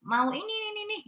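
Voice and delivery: put-on high-pitched whiny toddler asking for things; imitating her toddler. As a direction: mimic a small child asking. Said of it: "kalau anak saya bilang".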